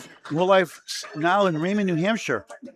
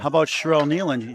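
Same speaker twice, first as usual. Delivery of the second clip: ordinary talk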